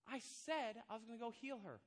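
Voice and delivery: ordinary delivery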